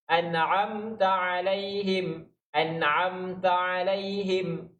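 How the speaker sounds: An'amta alaihim chanted very slowly, set apart from the speaking voice, nun and mim held; slow Quran recitation. Shown as a demonstration. Teacher chanting An'amta alaihim very slowly to the students. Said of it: "Mim pun sama".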